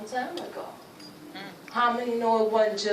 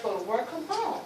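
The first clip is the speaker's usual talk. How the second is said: normal talking voice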